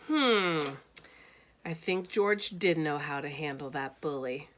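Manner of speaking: plain reading voice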